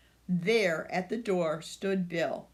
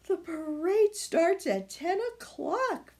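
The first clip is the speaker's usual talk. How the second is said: higher, worried grandma; fretting; 10 semitones higher than usual. A narrator's reading. Grandma worried about the parade, fretting. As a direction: fret about the time. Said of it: "fretted Grandma".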